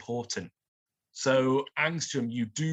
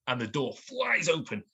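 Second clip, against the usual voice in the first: usual speaking voice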